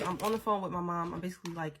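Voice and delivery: ordinary talk, nothing marked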